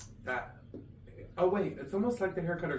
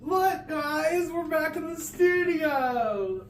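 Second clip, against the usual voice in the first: excited; 12 semitones higher, 6 dB louder, much slower than usual. Real, not acted. Excited show opener.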